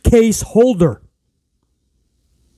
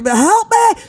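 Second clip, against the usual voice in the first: high-pitched imitated cry; mock screaming for help. Wrestler calling her partner back, high mock scream. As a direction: mimic a desperate plea in falsetto. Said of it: "Io is screaming for Bayley's help".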